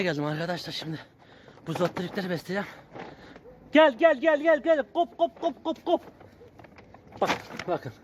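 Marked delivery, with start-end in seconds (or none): 3.7-6.0 very high sing-song call; calling the dogs. Real, not acted.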